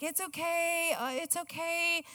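quiet, tender reassurance to a crying son; soothing a child. Retold or imitated. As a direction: hush him gently. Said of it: "I was like".

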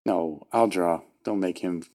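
ordinary delivery

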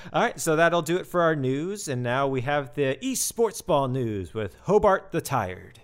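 upbeat segment intro; announcer. Acted. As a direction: introduce the next segment like a host.

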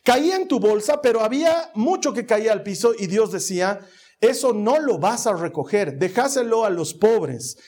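rising sermon voice, pushed and emphatic; fervent preaching. Acted. Pastor urging the congregation to forgive, fervent preaching.

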